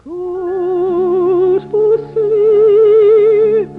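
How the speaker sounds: normal talking voice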